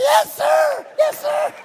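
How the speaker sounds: put-on scared teen answering the man with the gun; ten octaves too high. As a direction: blurt it out in a terrified squeak. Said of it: "about ten octaves too high".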